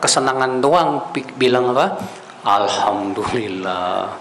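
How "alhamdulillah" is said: drawn-out alhamdulillah. Retold or imitated. Mocking people thanking for wealth.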